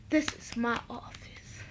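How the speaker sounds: hushed whispered retort. Retold or imitated. Lucius hissing at the police in a whisper. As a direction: drop to a whisper, indignant. Lucius insisting in retold scene.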